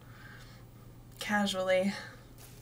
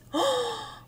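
awed gasp; 13 semitones higher than usual. Real, not acted. Gasps at the ivy.

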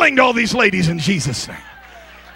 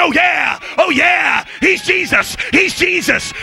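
high, excited crowing; boastful hype; 10 semitones higher, slower than usual. Acted. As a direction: hype up your famous friend like a swaggering entourage. Acts out boasting disciples.

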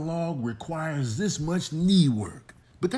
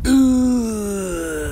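fake dying groan; 5 semitones higher, 9 dB louder than usual. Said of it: "I'm gonna play dead".

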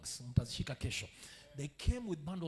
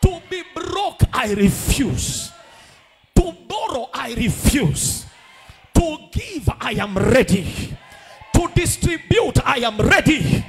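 slow, very loud vows; shouted declarations; 5 semitones higher, 19 dB louder, slower than usual. Acted. Pastor leading the church in shouted vows. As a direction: roar each vow and hold it.

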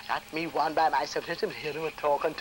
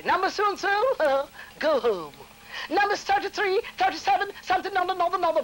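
clerk calling numbers; 12 semitones higher than usual. Retold or imitated. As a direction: rattle through the list like the clerk. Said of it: "I hear a lady say".

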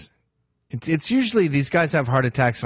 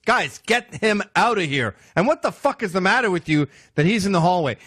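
irritated order; 7 semitones higher than usual. Retold or imitated. President ordering Secret Service to remove the intruder, high annoyed. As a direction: bark at the guards. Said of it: "he'd say to the Secret Service".

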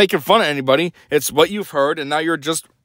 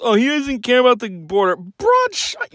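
higher-pitched mock complaint; mock an opponent's whining in a higher voice; mocking quote; 8 semitones higher than usual.

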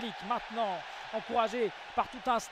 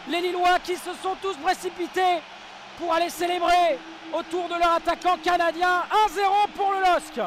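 excited sports commentary; 9 semitones higher, 9 dB louder than usual. Real, not acted. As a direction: roar the goal to the crowd. Commentator calls the goal.

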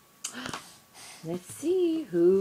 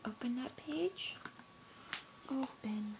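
whisper; 3 semitones higher, 9 dB quieter than usual. Real, not acted. Whispering to baby.

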